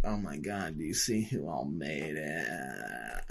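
soft, slow, snide whisper-like gossip voice; mock gossip. Mocking a catty guest she dreads meeting, soft and slow. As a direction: sneer it softly like a catty partygoer.

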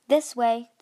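loud call over the storm; shouted the queen. A narrator's reading. Queen shouting to her daughters through a storm, loud call. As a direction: call it out over wind and rain. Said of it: "shouted the queen".

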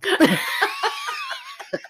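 a high, sudden burst of laughter. Real, not acted. Host laughing at naming hamsters Kilo and Eight Ball. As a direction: crack up at her own joke.

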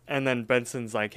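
usual speaking voice

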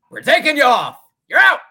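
loud, high, declared verdict; mock proclamation. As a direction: pronounce the sentence like a herald. Dramatic verdict ending mock ceremony.

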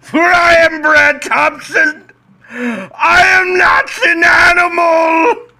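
high, loud, slow anguished shriek; seething shrieking spirit. Acted. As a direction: shriek it like a wounded monster.

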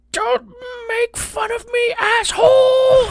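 high, loud, drawn-out scream of rage; first grader screaming at a bully, high and furious; furious yell. Retold or imitated. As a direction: scream it at the older kid, out of control. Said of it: "I think I yelled up".

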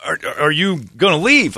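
ordinary delivery

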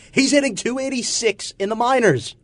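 high, loud ranting voice; Francesa rant impression. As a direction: rant like an outraged radio host.

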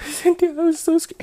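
high-pitched tearful plea; mock crying. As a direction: imitate wailing to a friend for sympathy. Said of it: "I'm, like, crying".

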